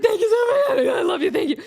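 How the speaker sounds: high, loud, laughing near tears; gushing thanks. Retold or imitated. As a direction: gush with overwhelmed gratitude. Starstruck fan thanking [name].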